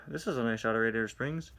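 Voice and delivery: usual speaking voice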